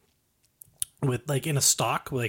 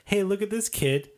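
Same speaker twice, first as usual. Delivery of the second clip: high mocking carny heckle; hammed-up jester taunt; heckling jester; 7 semitones higher than usual. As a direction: roast him like a jester on a stage. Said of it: "he goes".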